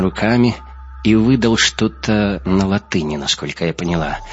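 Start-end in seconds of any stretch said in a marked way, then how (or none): none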